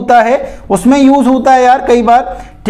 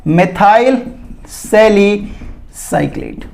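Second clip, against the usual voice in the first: methyl सैलिसाइक्लेट drawn out slowly, pause between the words; slow, broken-up naming; 2 semitones lower, 3 dB quieter, much slower than usual. Shown as a demonstration. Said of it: "उसका नाम है". (methyl सैलिसाइक्लेट धीरे-धीरे, रुक-रुक कर)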